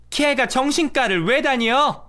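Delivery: high, slow, scoffing mother-in-law; dismissing it in disbelief. Acted. Mother-in-law reacts to news. (어이없어하는 시어머니)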